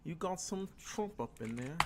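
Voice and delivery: slow, high, soft attempt at a British accent; a playful amateur British accent, drawn out. Streamer teasing his guest in a mock British accent. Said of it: "That's my best British accent".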